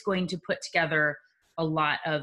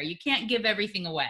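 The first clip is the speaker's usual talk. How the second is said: usual speaking voice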